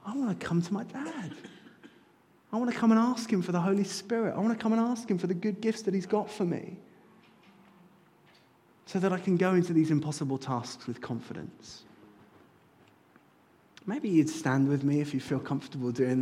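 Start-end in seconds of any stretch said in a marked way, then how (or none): 0.0-11.8 put-on eager child. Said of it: "we can say".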